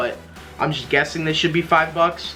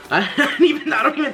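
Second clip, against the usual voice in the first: real laugh breaking into higher-pitched words; 9 semitones higher, faster than usual. Real, not acted. Vlogger amused at his own slip, laughing. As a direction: chuckle through the words, pitch jumping up.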